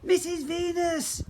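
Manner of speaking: high, slow, loud warning shout; all call out to wake her. Acted. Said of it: "Everyone joined in".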